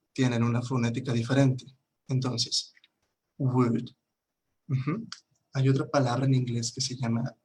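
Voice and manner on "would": would with no L sound, like "wood"; silent L. Shown as a demonstration. Teacher shows learners the phonetics, would with a silent L. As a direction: say would as a single careful word, L silent. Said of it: "La L no se pronuncia".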